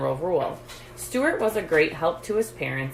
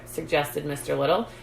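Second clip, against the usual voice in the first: usual speaking voice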